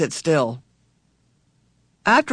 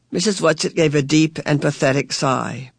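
plain reading voice

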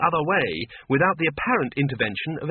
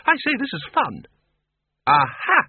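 much higher, delighted aha; a game show contestant enjoying himself; gleeful; 14 semitones higher, 5 dB louder than usual. Acted. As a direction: Windle getting into the game. Windle gleefully plays along.

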